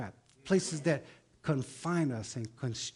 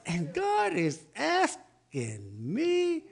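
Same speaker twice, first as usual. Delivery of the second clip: ordinary delivery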